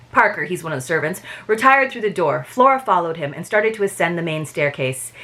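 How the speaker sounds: normal talking voice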